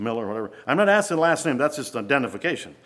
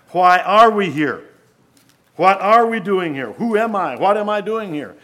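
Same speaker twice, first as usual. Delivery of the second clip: loud, high run of questions; preaching; 8 semitones higher, 10 dB louder than usual. Acted. Preacher voicing everyone's big questions, loud and high. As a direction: hammer out each question to the hall.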